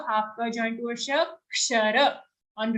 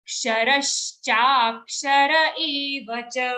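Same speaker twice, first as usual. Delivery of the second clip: slow, syllable by syllable, Ksharash cha; said slowly; 4 dB louder, much slower than usual. Shown as a demonstration. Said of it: "say it slowly".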